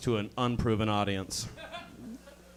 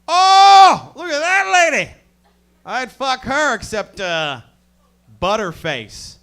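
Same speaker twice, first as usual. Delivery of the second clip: high, loud dumb bro voice; friend's voice; 13 semitones higher, 15 dB louder, slower than usual. Retold or imitated. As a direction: squeal it like a crude friend. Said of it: "but he was like".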